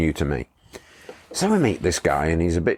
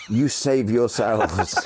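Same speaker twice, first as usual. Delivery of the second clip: higher, slow, laughing through it; mock heroic farewell; 5 semitones higher, slower than usual. Guide urging the riders to leave him, laughing mock heroism. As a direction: play the martyr while giggling. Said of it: "He says".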